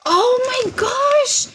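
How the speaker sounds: stretched out, high oh my gosh; slow high surprise. Real, not acted. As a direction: draw it out, high and amazed.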